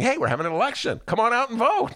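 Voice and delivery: high, bright mock-cheery call; mock cheerful invitation. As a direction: sell it like a cheery ad. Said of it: "they were saying".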